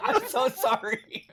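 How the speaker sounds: high, slow, through giggles; laughing apology. Real, not acted. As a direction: apologize while laughing helplessly.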